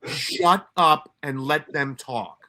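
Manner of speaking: slow, deliberate, emphatic command; blunt order. Retold or imitated. Sergeant correcting a new detective, blunt slow order. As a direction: spell it out slowly, word by word.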